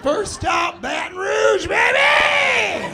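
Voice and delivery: high, loud whoop building to a long holler; rousing shout. Pretend. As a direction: holler the tour stop to the crowd, stretching the last word. Calling out a tour stop.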